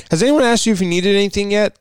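lightly mock a waitress's pointed question; waitress with attitude. Waitress checking on a non-buying customer, lightly imitated with attitude. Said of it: "essentially with that attitude".

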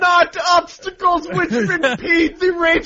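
angry German. Acted. Host ranting at the obstacles as an angry German. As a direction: rant it like a furious German. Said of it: "really angry German".

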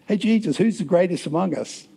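eager disciples. Acted. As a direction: ask it high, like a disciple.

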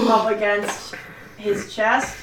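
ordinary delivery

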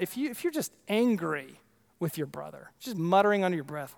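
normal talking voice

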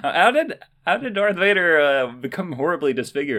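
high mock-innocent question; put-on kid questioner. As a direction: play a kid asking an old Jedi for the story. Plays a kid questioning Obi-Wan.

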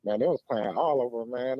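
ordinary talk, nothing marked

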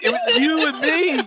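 high, pleading lovesick whine; mock lovesick coach. Fired coach begging Bill back in a high lovesick whine. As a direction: beg like a heartbroken ex. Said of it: "He'll just be like".

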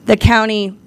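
normal talking voice

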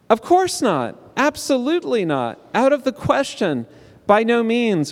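high, exclaimed refusal; indignant string of denials; emphatic denial. Acted. Voicing emphatic translations of Paul's exclamation.

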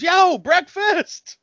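high, loud, drawn-out call; yelling out. Retold or imitated. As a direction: holler it through the door slowly. Kid yelling out door.

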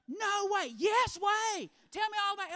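high, loud amazed voice; a king who can't believe his ears. Acted. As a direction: squeal it like a starstruck king. King reacts to Gehazi's story.

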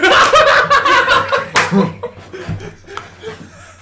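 high, loud disbelieving laugh; loud burst of laughter. Real, not acted. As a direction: crack up hard at the friend. Reaction to the line.

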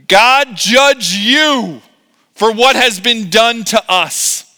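high, loud, drawn-out condemnation; angry rebuke. Acted. Foreman blames Moses and Aaron, angrily. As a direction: pronounce it slowly like a curse.

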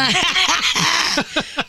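high, real cackling laugh; burst of laughter. Real, not acted. Woman cracking up at the insurance remark, high laughter. Laughs at her co-host's joke.

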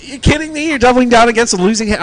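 high, fast, disbelieving; you can't be serious, rushed and high; sharp incredulous jab at a bad bet; incredulous disbelief. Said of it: "I'm like".

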